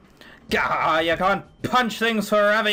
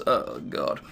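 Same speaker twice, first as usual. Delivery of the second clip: groan; 4 dB quieter than usual. Real, not acted. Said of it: "do your accent".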